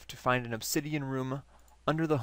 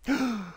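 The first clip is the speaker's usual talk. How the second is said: sharp surprised gasp; 9 semitones higher than usual. Acted. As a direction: gasp as if recognizing someone. Scientist recognizes the player.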